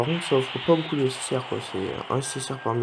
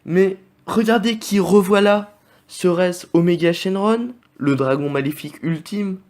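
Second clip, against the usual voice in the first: louder, higher showman reveal of Omega Shenron; game-show reveal of the ultimate evil dragon; mock announcer hype; 6 semitones higher, 7 dB louder than usual. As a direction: present the dragon like a TV host. Hyping the same rare pull again.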